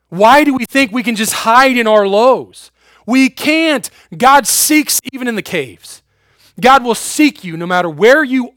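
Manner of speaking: loud, high full-force preaching; fiery preaching. Acted. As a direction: preach it out to the congregation.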